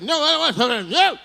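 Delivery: high, whiny mock protest; whiny refusal.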